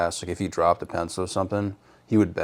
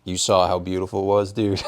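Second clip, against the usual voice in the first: normal talking voice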